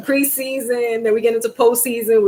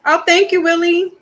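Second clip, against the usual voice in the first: bright thanks; 8 semitones higher, 4 dB louder than usual. Real, not acted. Thanking friend.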